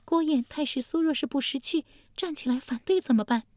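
high, careful court lady; high consort treading lightly; cautious consort. Acted. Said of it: "丽姬陪着小心道".

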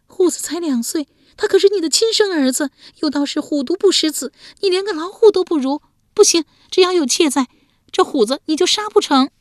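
wife crying to save her son. A narrator's reading. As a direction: sob out the defiance. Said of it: "哭着说".

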